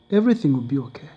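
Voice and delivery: fast, brisk comfort; quick reassurance. Retold or imitated. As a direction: rush the reassurance. Widow comforts him.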